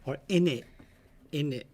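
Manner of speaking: casual, soft British "in it"; British accent. Acted. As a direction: toss it out in British slang. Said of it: "You have a good British accent".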